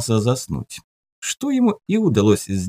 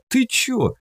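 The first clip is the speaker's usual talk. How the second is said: high surprised "ты чё". Acted. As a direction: jump up high in disbelief. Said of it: "удивился Жуков".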